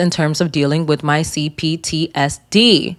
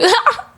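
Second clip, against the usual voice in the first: sudden high giggle; high laugh. Real, not acted. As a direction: burst into a squeaky laugh. Laughing at own joke.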